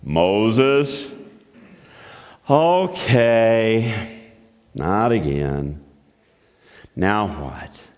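tired of being called again; understated fed-up shepherd; weary Moses. Acted.

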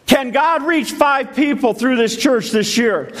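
asked high, at full preaching force; repeating the question with fire this time. Real, not acted. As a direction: lift it up and preach it.

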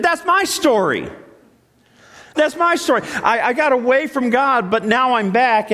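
mock testimony, pitched well up; voiced congregant. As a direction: speak up as someone in the pew owning it. Congregant voiced saying it's their story.

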